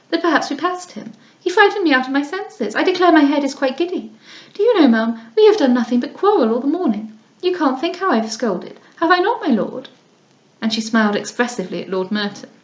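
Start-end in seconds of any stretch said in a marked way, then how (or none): 0.1-9.9 put-on affected young lady. Lady Louisa complains to Mrs Beaumont about the dust, affectedly high. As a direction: whine about the dust like a pampered girl.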